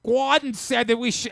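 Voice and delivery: put-on loud, high burst of Boston accent; mock Boston accent. Host mimicking a MasterChef winner's sudden Boston accent outburst. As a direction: blare it in an exaggerated Boston accent. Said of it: "And all of a sudden".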